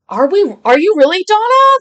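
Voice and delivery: sudden high, loud, disbelieving question; jolted into a loud, incredulous ask; surprised disbelief. Real, not acted. Streamer reacting in disbelief to viewer Donna, high and loud.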